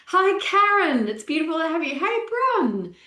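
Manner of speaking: high, bright hello to viewers; light up as you greet them; excited greeting. Real, not acted. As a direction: sing out a welcome to each viewer. Greeting live viewers by name.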